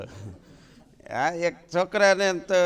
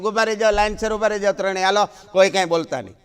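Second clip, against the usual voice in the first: brisk orders; 4 dB louder, faster than usual. Acted. Mother ordering quarrelling kids.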